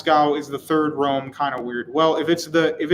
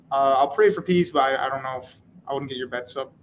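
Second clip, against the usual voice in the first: ordinary talk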